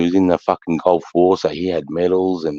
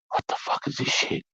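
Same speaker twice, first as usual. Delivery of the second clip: whispered mock inner voice; whispered thought; 9 semitones higher, 10 dB quieter than usual. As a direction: imitate a hushed, disbelieving whisper. Recruit's stunned thought at night.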